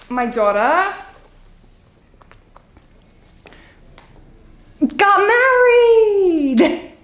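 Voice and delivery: chatty Long Island mother telling all; dramatic housewife with drawn-out punchlines; Sheila, Long Island housewife. Acted. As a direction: play a confiding, theatrical suburban mom. Plays monologue housewife Sheila.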